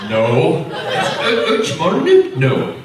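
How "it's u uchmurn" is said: Uchmurn laughed out high and rising; mock mispronunciation. Laughing imitation of visitors saying Uchmurn. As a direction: giggle through the wrong Uchmurn. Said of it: "people actually have come and said".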